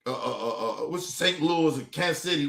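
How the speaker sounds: lazy mock of the strategist, stammering uh uh. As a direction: dismissively parrot her words, lightly voiced. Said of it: "Talking about".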